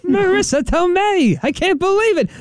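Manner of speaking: high, slow, whiny George voice; imitating George. Hosts recalling Seinfeld, whiny high George excitement. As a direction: squeal out the name like a giddy George Costanza. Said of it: "George thought he was gonna get set up with".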